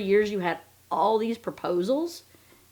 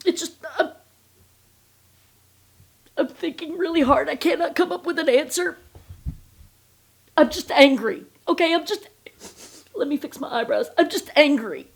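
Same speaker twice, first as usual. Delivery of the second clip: much higher and louder mock interview voice; mocking Robin; 8 semitones higher, 5 dB louder than usual. Mocking Robin stalling in interviews, high hammed-up voice. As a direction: ham up her flustered non-answer. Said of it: "she'd be like".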